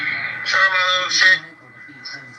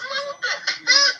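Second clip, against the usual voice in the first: laughing disbelief; much slower than usual. Retold or imitated. Retelling her asking to be paid.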